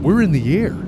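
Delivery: thrilled as the wheels lift off; excited. Real, not acted. Takeoff excitement, giddy flyer.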